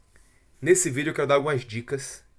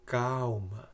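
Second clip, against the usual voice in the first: soft, soothing reassurance; calming inner voice; 3 semitones lower, 8 dB quieter than usual. Acted. Preacher voices the Holy Spirit comforting an anguished listener, soft and calming. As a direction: say it low in volume, gently consoling. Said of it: "te confortando e dizendo".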